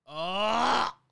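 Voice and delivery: loud, high excited "ahh"; excited cry. Real, not acted. Film fan buzzing for Dune Part Two, excited cry. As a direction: let out a throaty burst of excitement. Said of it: "I was that excited".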